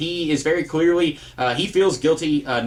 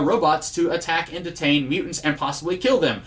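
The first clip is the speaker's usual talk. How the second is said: normal talking voice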